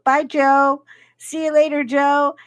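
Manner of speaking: mock cheery send-off; called-out goodbye. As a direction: call goodbye to a colleague as you leave. Retold officers calling goodbye.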